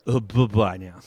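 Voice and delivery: sweetly dismissive airline goodbye; stewardess voice. Acted. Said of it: "in my famous stewardess voice".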